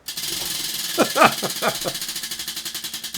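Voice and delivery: long, high cackle; high laughter. Real, not acted. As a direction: crack up after your own bit. Cracks up after the greeting.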